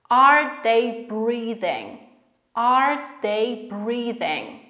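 very slow, each word separated; slow pronunciation drill. Shown as a demonstration. Teacher asks learners to repeat the question, slowly word by word. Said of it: "Będziemy sobie tak trochę dzielić to wszystko".

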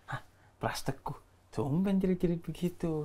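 low, hushed remark to oneself; retold quiet surprise. Remarking on [name] screaming at a show, low retold murmur. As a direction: drop it low and very soft. Retelling his muttered reaction backstage.

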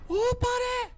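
very high loud cry of fright; real frightened yelp; startled shriek. Real, not acted. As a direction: shriek at the sudden scare. Another jump scare.

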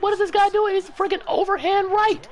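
very high, loud rant; furious high yelling. Real, not acted. Gamer rants at the opposing player, very high and loud. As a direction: rant up in a strained high register.

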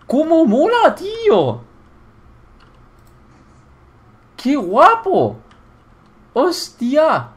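high, loud, drawn-out exclamations; ecstatic admiration. Real, not acted. Player loving the shower, high thrilled shouting. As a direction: gush at the top of the voice.

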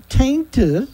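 "tainted" echoed high, lifting at the end; pointed repeat. Real, not acted. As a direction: stress "tainted" as if you can't believe it. Repeats the word in disbelief.

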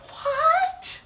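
high startled squeak; shocked what. Acted. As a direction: react in disbelief. Reacting to silly lines.

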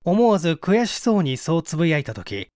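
usual speaking voice

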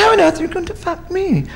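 very high, astonished question; incredulous elephant. Acted. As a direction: squeal it in disbelief as the elephant. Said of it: "She said".

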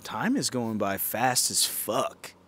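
usual speaking voice

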